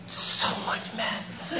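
breathy laugh through the words; laughing it out, half whispered. Real, not acted. Laughs at own confession.

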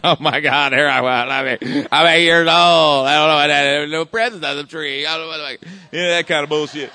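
loud, high, hammed-up blubbering; mock bawling. Recalling crying in his therapist wife's questions, mock bawling. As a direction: wail it like a grown man crying on the couch. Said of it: "and I'm, like, bawling".

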